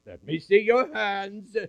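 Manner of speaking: put-on high, loud old woman's request; eagerly ask, rising high on the hands; Anna demanding. Anna asks Joseph to show his hands, high and loud. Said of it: "She said".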